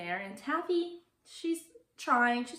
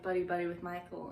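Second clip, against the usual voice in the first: usual speaking voice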